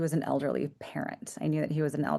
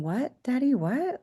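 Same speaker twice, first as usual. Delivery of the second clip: put-on young child's confusion; 7 semitones higher than usual. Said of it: "And I remember going".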